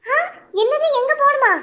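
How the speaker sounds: can't believe he forgot; shocked disbelief. Acted. Wife shocked husband forgot. (அதிர்ச்சியுடன் மனைவி கணவனிடம் கேட்கிறாள்)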